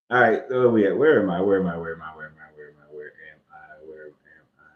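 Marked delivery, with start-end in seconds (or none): 1.0-4.6 each repeat fainter, as if echoing away; fading echo. Pretend. Preacher mocking himself lost in the text, fading echo. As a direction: let it echo off into the distance.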